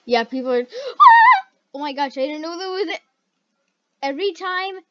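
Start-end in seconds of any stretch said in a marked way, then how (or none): 0.6-1.5 scared scream. Real, not acted. Player startled mid-sentence every time, scared scream. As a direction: gasp into a high, loud shriek. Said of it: "I always get scared".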